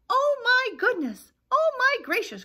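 very high, loud flustered chick; croaked panic. Acted. Chicken Little answers the turkey, high mock panic. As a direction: cry it out in hammed-up alarm. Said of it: "croaked Chicken Little".

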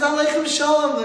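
put-on light French-accented reply. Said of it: "in that French accent".

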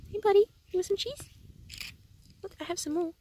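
high, tender pet voice; cooing at bird. Real, not acted. Talking to bird.

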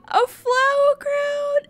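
high, drawn-out delighted squeal; wowed, high and stretched out; thrilled surprise. Real, not acted. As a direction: squeal slowly over a lovely gift.

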